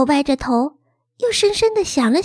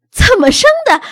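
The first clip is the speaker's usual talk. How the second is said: higher and louder, caught off guard; startled echo; 7 semitones higher, 8 dB louder than usual. A narrator's reading. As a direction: bounce the question back in surprise. Mother startled by child's question. (妈妈吃惊地反问，音调高而响)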